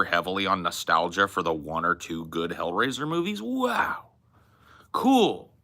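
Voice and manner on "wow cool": impressed amazement. Retold or imitated. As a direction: marvel in a high, hushed voice. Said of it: "I was like, "Whoa".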